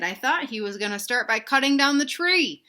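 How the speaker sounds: usual speaking voice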